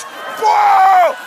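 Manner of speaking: very high shouted whoa of shock; startled yelp. Retold or imitated. Hot wax stuck up his nose, startled yelp.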